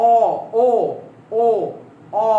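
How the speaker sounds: অ, ও, ও, অ said alone with pauses, lips rounding and opening; isolated Bengali vowels অ ও ও অ; vowel demo. Shown as a demonstration. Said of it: "আমি বললাম".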